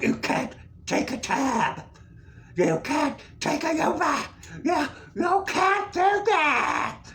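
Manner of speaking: high, mocking shout, building; mock insistent yelling. Staff telling him no cab, mock yelling. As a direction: build to a stretched high holler. Acting out people refusing him.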